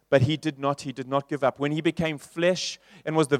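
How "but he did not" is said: ordinary delivery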